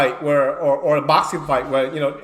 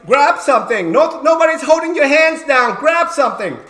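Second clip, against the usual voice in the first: higher, louder command to grab; insistent yell; 8 semitones higher, 6 dB louder than usual. Retold or imitated. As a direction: bark at the wrestler to act. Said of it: "so I said".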